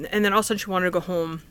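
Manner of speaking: ordinary talk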